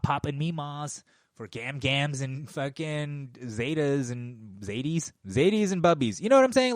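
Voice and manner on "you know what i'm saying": excited outburst. Real, not acted. Ranting about grandparents dying.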